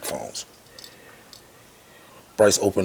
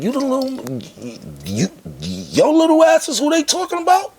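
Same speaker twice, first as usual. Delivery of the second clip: much higher, louder mocking mogul voice; incredulous boss; 5 dB louder than usual. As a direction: look him up and down in disbelief. Boss sizing up young rapper.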